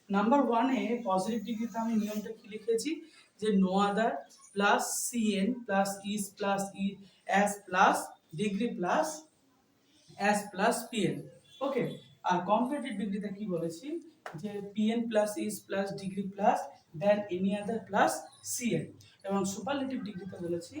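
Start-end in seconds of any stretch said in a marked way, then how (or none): 3.6-11.2 slow dictation. Shown as a demonstration. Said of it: "dictating a rule slowly". 14.7-18.9 slow dictation. Shown as a demonstration. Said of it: "dictating a rule slowly".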